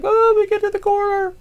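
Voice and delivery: high whiny wrestler voice; mock wrestler plea. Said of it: "He's like".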